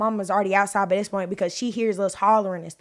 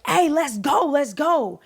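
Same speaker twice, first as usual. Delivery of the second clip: hollering; 6 semitones higher, 4 dB louder, slower than usual. Retold or imitated. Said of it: "he hollers like".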